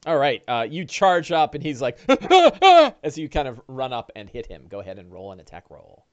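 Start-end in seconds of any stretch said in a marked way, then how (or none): 2.1-2.9 string of high, loud yelps; panicked cries. Acted. Orc as the player charges up, high panicked yelping. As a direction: yelp "ah" in quick high bursts.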